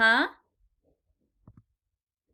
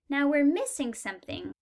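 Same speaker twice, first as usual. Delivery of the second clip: normal talking voice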